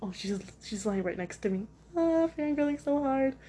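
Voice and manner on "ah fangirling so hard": high-pitched fangirl gush; squealy fangirl fawning. Real, not acted. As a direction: gush over something adorable, voice pitched up. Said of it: "Why am I fangirling over a cat".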